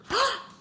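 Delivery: high sharp theatrical gasp; mock gasp. Storyteller gasping at the vendor at the door. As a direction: gasp big for the kids.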